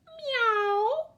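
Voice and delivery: high, loud cat's meow; a big high yowl of a cat. A narrator's reading. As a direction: mew like a startled cat. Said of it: "cried Wilbur".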